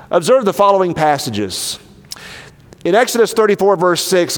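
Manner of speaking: normal talking voice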